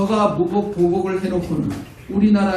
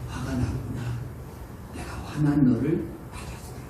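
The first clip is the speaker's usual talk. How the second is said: softer, quiet and accepting; gentle Lord; 3 semitones lower, 7 dB quieter than usual. Retold or imitated. The Lord accepting an angry believer, soft and tender. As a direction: soothe an angry child. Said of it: "주님께서 말씀하실 거예요".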